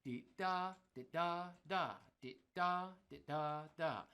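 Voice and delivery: lilting "dee da", short then long; swung phrasing. Shown as a demonstration. Teacher showing the exercise swung, loose long-short phrasing. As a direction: swing the syllables, stretching each "da". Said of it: "Or if we swing it".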